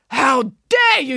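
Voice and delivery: slow, high, loud indignation; outraged yell. A narrator's reading. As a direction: stretch it out in a furious yell. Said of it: "yelled the vice principal".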